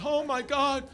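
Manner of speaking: high, slow, drawn-out mock lament; hammed-up grief. Comic mimicking somber koala news, hammed-up lament. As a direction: wail it slowly in exaggerated mourning. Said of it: "they made it so somber".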